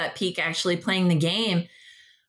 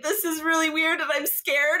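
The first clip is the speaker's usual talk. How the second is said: mock scared clerk; 10 semitones higher than usual. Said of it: "he was just like".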